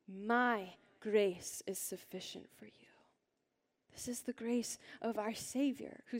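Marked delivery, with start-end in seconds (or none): 0.1-2.7 slow, with "My" drawn out and set apart; stress on My. Shown as a demonstration. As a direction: lean hard on "My", then finish deliberately. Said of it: "this next word should be my".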